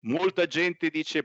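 ordinary talk

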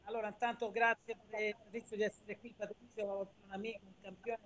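faint, distant phone voice; far from mic. Real, not acted. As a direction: caller too far from the microphone. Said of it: "la voce arriva veramente bassa".

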